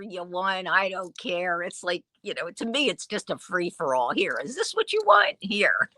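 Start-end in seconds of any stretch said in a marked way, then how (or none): 4.1-5.9 high, breezy offer tossed off; mock careless seller. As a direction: hand it over like it's worthless junk. Mock seller handing out items.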